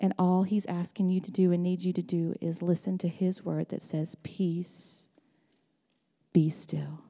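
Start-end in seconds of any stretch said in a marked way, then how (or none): 4.2-7.0 soft hushed whisper. Acted. Pastor whispering Jesus' words to the congregation. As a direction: breathe it out gently.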